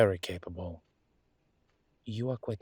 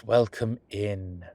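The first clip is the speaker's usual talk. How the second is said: louder, slow welcome to the class; 6 dB louder, slower than usual. Acted. Professor greeting the class, a louder slow welcome. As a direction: project it to the whole room.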